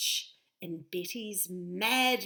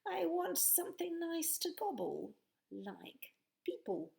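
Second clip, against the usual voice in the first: menacing dragon, whispering; 9 semitones higher than usual. Acted.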